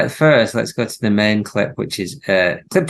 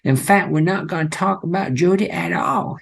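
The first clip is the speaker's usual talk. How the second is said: mock Southern accent; 8 semitones higher than usual. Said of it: "An, an accent so unconvincing".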